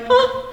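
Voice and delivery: high, brief mimicked whimper; imitated whimper. Ghost hunter showing colleague what was heard, imitated whimper. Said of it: "It sounded like a whimper".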